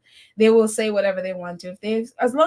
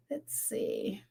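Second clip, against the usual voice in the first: whispered aside; 2 semitones lower, 16 dB quieter than usual. Real, not acted. Whispered aside while scrolling chat.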